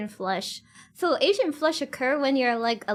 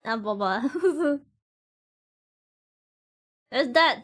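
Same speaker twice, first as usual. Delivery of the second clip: ordinary delivery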